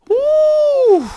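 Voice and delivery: a sharp, high whoop of feeling; overwhelmed high whoop; high woo. Real, not acted. Recalling losing it at the film.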